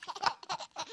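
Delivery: put-on high giggling baby laugh. Baby laughing after throwing the tea, high and gleeful. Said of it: "starts to laugh".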